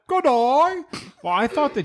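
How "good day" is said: high mock-cockney greeting; hammed-up cockney. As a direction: chirp it in a put-on cockney, pitched way up. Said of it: "with a cockney accent".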